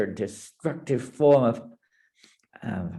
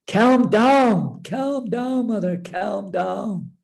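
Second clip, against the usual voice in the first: soothing plea; 8 semitones higher, 3 dB louder, much slower than usual. Acted. Lord Narayan soothing Parvati.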